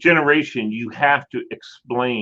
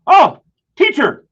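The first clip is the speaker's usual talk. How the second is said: much higher, slow, drawn-out greeting; high mock-surprised disciples; 6 dB louder, slower than usual. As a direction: call out in cartoonish surprise. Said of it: "saw him and said".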